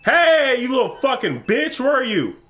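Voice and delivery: loud, high furious yelling at the creature; angry yell. Real, not acted. Gamer yelling at a monster, furious shout. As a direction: scream insults at it.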